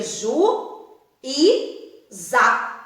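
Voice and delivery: ju e za as three drawn-out, separate syllables: ju, i, za. Shown as a demonstration. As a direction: space out ju e za like a slow syllable count. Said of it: "a separação seria". (ju e za, sílaba por sílaba, devagar)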